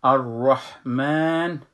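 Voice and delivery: الرحمن stretched slowly with a heavy ra; full ra. Shown as a demonstration. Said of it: "را میخوانم".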